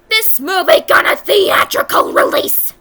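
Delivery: loud, high incredulous yell; ranting, over-the-top shout; outraged disbelief. Real, not acted. Outraged disbelief at theatrical release.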